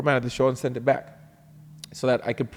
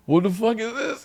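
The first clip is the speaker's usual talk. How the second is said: shocked; 10 semitones higher than usual. Retold or imitated. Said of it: "and I go".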